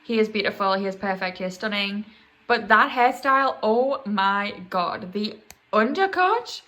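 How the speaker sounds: loud, gushing fangirl; excited. Real, not acted. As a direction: swoon over him out loud. Gushing over [name]'s hair.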